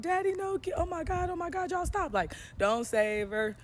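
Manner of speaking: high pleading whine, mock panic; a friend begging them to stop beating her pimp in a high mock panic; pleading girlfriend. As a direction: squeal like a panicked girl begging her man. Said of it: "talking about".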